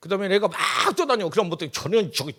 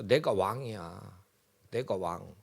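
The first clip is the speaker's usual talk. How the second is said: 교만한 사울 흉내; 9 semitones lower, slower than usual. Acted. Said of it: "뒤집어져 버렸어".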